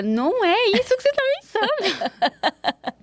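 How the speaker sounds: high, slow, pleading denial; mock defensive teen. Daughter imagines assuring her mother, high flustered pleading. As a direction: play the flustered teen protesting too much.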